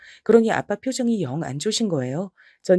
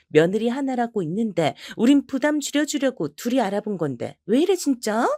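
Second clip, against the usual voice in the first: higher, exasperated scolding; snapping back at her sister-in-law; irritated sister-in-law; 6 semitones higher than usual. Acted. (높은 톤으로 짜증 내며 따지는 시누이)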